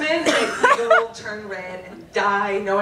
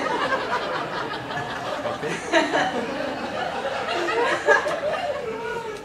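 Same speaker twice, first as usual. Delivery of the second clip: helpless laughter; 10 semitones higher, 3 dB quieter than usual. Real, not acted. Reading her embarrassing diary.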